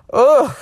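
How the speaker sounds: loud, high groan of disgust; disgusted ugh. Real, not acted. Fed up with the husband's rudeness, a loud ugh.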